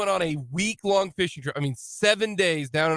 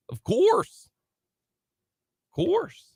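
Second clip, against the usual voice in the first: put-on high, goofy Minnesota accent; Minnesotan accent; 8 semitones higher, much slower than usual. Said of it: "That's my Minnesotan accent".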